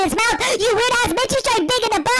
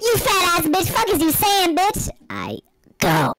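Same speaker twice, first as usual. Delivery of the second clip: ordinary talk, nothing marked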